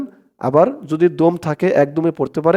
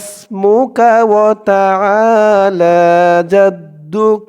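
drawn out recitation; 3 semitones higher, 4 dB louder, much slower than usual. Shown as a demonstration. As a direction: draw every syllable out long. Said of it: "আমি একবার টেনে টেনে বলবো". (টেনে টেনে ধীরে তিলাওয়াত)

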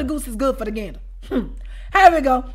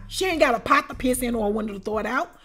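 ordinary delivery

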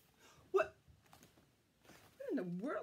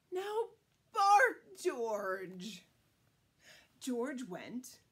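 plain reading voice

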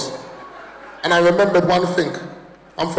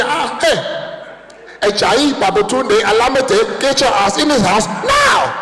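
Nigerian mum yelling; 6 semitones higher, slower than usual. Retold or imitated. Nigerian mum calling her son in, angry yell. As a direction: bellow the full name across the street. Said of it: "She be".

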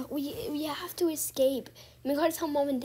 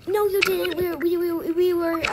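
loud protest; 4 semitones higher, 8 dB louder than usual. Acted. Kid protests loudly.

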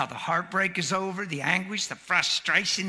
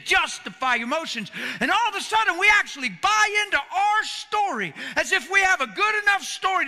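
high, loud preaching cry; full-force preaching; 6 semitones higher, 5 dB louder than usual. Acted. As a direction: preach it out to the whole room.

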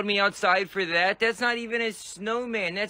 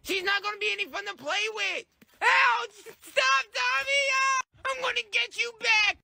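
put-on frantic little boy; 14 semitones higher than usual. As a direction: cry out for time out and new gloves. Kid in snowball fight.

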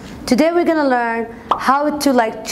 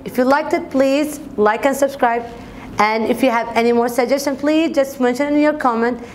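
normal talking voice

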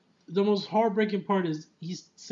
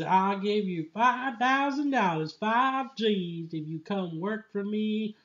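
higher, hammed-up country-boss voice; 6 semitones higher, slower than usual. Farmer offering the clone a job, hammed-up voice. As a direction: play the sleazy farmer offering cash.

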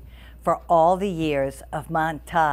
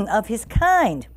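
plain reading voice

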